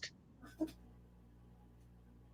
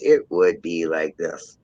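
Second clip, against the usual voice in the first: much lower, slow, natural voice; 13 semitones lower, slower than usual. Shown as a demonstration. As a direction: let the voice sit way down low. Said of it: "I make my voice this way. Otherwise".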